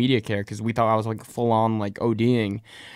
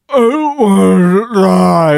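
high, loud, slow wail of panic; mock panicked scream; 8 semitones higher, 13 dB louder, much slower than usual. Terrified kid to his aunt, loud slow wailing. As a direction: wail it like you're sure you're dying. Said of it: "I'm, like, screaming".

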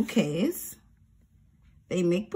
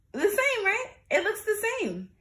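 surprised; 12 semitones higher than usual. Shown as a demonstration. Teacher exaggerates surprise.

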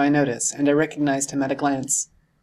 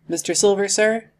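higher-pitched polite question; questioning address; 6 semitones higher, 3 dB louder than usual. A narrator's reading. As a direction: call him politely, pitched up. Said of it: "I asked".

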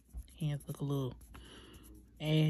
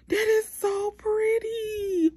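much higher, slow, crying with joy; teary joy; 6 dB louder, much slower than usual. Real, not acted. Nearly crying over how pretty her nail came out, tearful joy. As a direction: let the voice break with happy tears.

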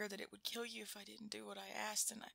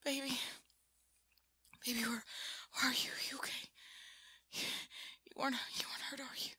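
hushed, worried whisper; anxious whisper; 4 semitones higher, 4 dB louder, faster than usual. Acted. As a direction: whisper to check they're alive. Anxious whispered check on partner.

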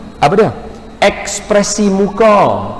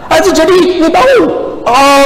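much higher, louder exasperated protest; heated denial; 11 semitones higher, 4 dB louder, slower than usual. Retold or imitated. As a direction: throw up your hands and deny it loudly.

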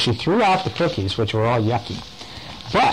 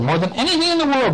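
high squeaky echo; 13 semitones higher, faster than usual. Acted. Repeats the voice's question.

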